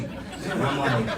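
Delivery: normal talking voice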